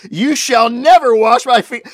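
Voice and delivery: loud, high, slow indignant protest; shocked refusal. Acted. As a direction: plant your feet and refuse your master. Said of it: "he's shocked".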